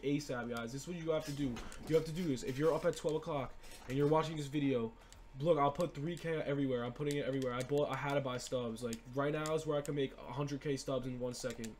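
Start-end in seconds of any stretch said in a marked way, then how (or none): none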